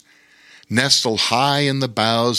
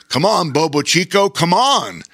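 higher-pitched rallying call; 7 semitones higher, 4 dB louder than usual. A narrator's reading. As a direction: call a friend to follow along. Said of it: "called Bear King".

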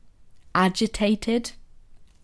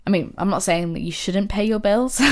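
ordinary talk, nothing marked

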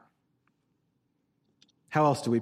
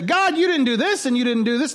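raised-pitch mock grievance; mock accusing complaint; 6 semitones higher, 3 dB louder than usual. Preacher imitating people blaming God in prayer, mock accusing.

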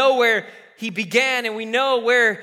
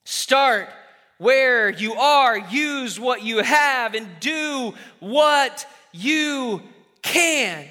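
slowed way down, each phrase weighed; preacher's closing cadence; slow emphatic summary; much slower than usual. Shown as a demonstration. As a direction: land the three points deliberately. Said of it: "closing summary, slowed".

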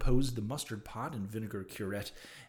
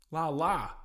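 higher, louder cry of alarm; chambermaid's cry; 5 semitones higher, 5 dB louder than usual. A narrator's reading. Chambermaid alerting the house, crying out. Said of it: "she cried".

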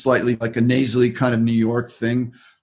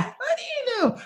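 much higher mock girlfriend voice, softer; puzzled girlfriend mimicked in a much higher voice; imitating girlfriend; 7 dB quieter, much faster than usual. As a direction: pitch way up to play the puzzled girlfriend. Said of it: "She goes".

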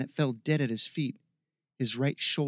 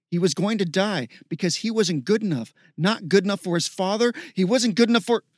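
higher, louder, building to a peak; impassioned narration rising in pitch and volume; building desperation; 5 semitones higher, 6 dB louder than usual. A narrator's reading. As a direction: let anguish mount with each phrase. Narrating hero's building despair.

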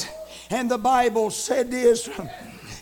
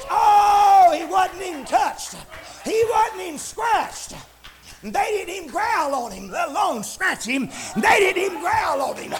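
fervent, amazed preacher's cry; exultant preaching; 6 semitones higher, 4 dB louder than usual. Real, not acted. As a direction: marvel aloud at the miracle. Preaches at full force.

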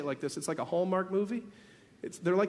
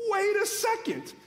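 high, sharp stop-everything call; sudden exclamation; 10 semitones higher than usual. Real, not acted. Preacher to congregation, a startled high call. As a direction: break in as if struck by the thought.

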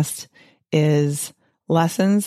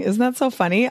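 ordinary talk, nothing marked

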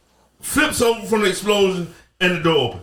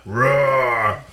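dinosaur voice; 7 semitones lower than usual. Pretend. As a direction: lower mock roar. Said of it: "your voice deeper than mine".

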